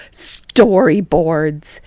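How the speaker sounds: Storyboards drawn out slow and loud; emphatic repeat. Acted. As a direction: drag out Storyboards to make the point. Kaminari repeating word for emphasis.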